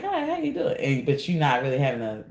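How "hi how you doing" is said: very high, sing-song friendly hello; imitating a bubbly passer-by; cheery stranger greeting. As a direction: greet like a beaming stranger passing by. Smiling stranger greets you.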